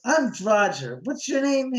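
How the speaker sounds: high, imitated buddy-up introduction; chummy assistant director. First AD putting his arm round neighbor, chummy introduction. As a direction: pitch it up, pointedly friendly.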